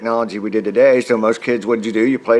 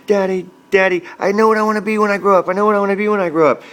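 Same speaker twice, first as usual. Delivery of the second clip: put-on high, fast, breathless kid voice; a boy bursting in to tell his dad; excited little boy; 9 semitones higher, faster than usual. Son running home to tell dad, high and fast. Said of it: "I'm like".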